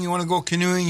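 normal talking voice

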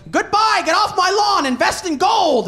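high, loud hammed-up holler; cranky director shouting. Old director quitting the commentary midway, hammed-up cranky shout. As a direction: yell it like a crotchety old man storming out. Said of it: "he just goes".

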